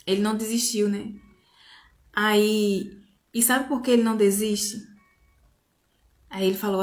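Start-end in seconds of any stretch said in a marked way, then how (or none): none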